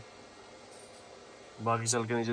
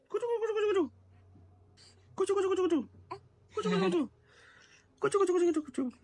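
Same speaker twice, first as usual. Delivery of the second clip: very high cooing kuchu kuchu. Real, not acted. Father playing with baby on camera, high cooing.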